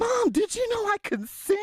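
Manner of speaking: a daughter begging to be heard; Rita pleading. Acted. Daughter to mother about her singing, high and hopeful. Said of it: "I'm Rita".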